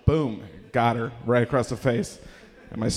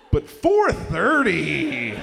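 incredulous; 5 semitones higher, 6 dB louder, much slower than usual. Retold or imitated. Parents incredulous.

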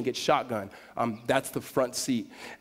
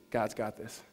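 low, soft word of comfort; hushed reassurance; 4 semitones lower, 7 dB quieter, slower than usual. Retold or imitated. Mom steadying her freaking-out son, hushed reassurance. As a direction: drop your voice to settle someone panicking.

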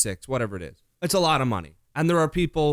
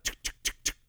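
whispered tsk ratchet clicks; ratchet clicking sound; 10 dB quieter, much faster than usual. Retold or imitated. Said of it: "you're like".

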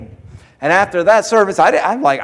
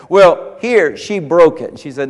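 ordinary talk

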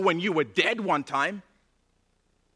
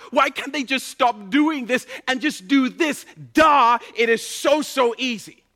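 louder, higher exasperated scolding; self-righteous rant; 5 semitones higher, 5 dB louder than usual. Retold or imitated. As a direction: act like a smug critic of the nation. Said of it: "we'll say stuff like this".

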